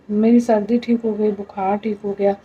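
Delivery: normal talking voice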